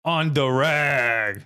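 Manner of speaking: slow, drawn-out tough-guy sneer; greaseball Guido voice. Retold or imitated. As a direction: drag it out like a Jersey guido. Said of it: "some greaseball Guido would say".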